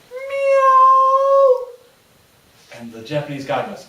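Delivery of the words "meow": very high, loud drawn-out meow; cat meow. Retold or imitated. Brit hiding from the guard fakes a loud high cat meow. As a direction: mimic a cat to fool the guard. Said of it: "the Brit goes".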